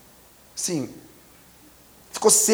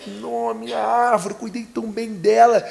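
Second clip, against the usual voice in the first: mock crying lament; 6 semitones higher than usual. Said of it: "Ele começou a chorar".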